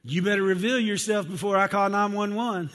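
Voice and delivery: high, loud mock threat; hammed-up warning shouted through the door; alarmed householder. Householder to unknown knocker, mock alarmed shout. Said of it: "that's what you'd be saying".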